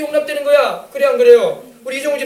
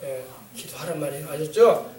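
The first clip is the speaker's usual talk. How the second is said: usual speaking voice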